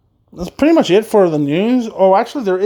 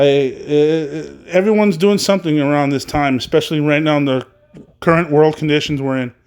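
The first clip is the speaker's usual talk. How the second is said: ordinary talk, nothing marked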